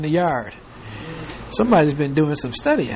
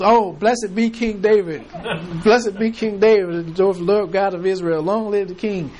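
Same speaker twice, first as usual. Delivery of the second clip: higher, giggling through a hammed-up blessing; laughing mock prophecy; 3 semitones higher than usual. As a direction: praise the king while cracking up. Messenger bursts into prophecy, laughing.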